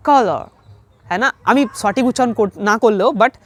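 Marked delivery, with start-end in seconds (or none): 0.1-0.4 British কলার with the final r barely sounded; British accent. Shown as a demonstration. Teacher shows learners UK pronunciation of কলার, British accent. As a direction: say কলার the UK way, tiny r. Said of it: "UK যদি উচ্চারণ করি".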